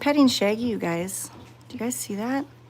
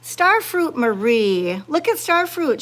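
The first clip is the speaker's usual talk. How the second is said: playful teasing; 5 semitones higher, 6 dB louder, slower than usual. Pretend. Teasing the jealous cat.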